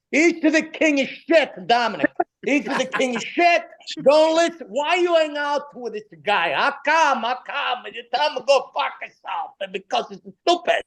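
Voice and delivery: put-on high-pitched, ranting Italian-accented father; dad's broken English. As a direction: imitate a worked-up Italian dad griping in broken English. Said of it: "my father was all broken English".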